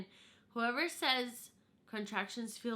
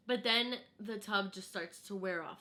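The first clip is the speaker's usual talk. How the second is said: usual speaking voice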